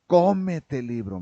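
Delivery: a father scolding his son about schoolwork; strict father. Retold or imitated. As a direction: hammer in the study order like a stern dad. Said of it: "me decía, así con esa expresión".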